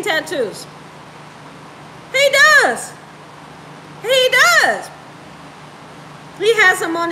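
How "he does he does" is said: high, loud insistence on DOES; emphatic insistence. Real, not acted. Wife insisting to viewers her husband has the tattoos, emphatic. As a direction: push DOES up high, then drop.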